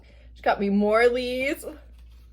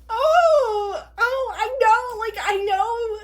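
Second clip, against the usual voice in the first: very high, emotional squeal; giddy recognition, pitched way up; so excited; 11 semitones higher than usual. Real, not acted. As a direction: squeak it out, overwhelmed with delight. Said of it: "I'm so excited".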